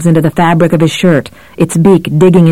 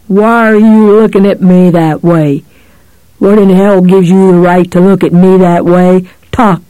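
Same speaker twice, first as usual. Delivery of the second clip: suspicious demand; 2 semitones higher, 3 dB louder than usual. A narrator's reading. Cop challenges Johnny suspiciously.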